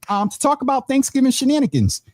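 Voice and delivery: high, playful hype on shenanigans; excited. Real, not acted. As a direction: sell the fun. Host hypes topic.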